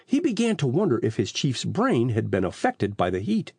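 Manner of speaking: plain reading voice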